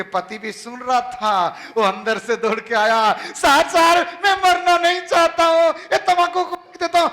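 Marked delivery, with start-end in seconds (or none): 3.1-7.1 high pleading husband; panicked plea. Retold or imitated. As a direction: beg not to die.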